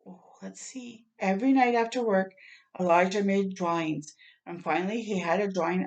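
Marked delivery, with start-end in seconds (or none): 0.1-1.1 hushed whisper, slow; slow secret whisper. Real, not acted. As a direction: breathe it out in suspense. Reader whispers in suspense.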